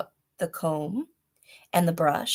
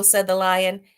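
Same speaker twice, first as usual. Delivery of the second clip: ordinary delivery, nothing marked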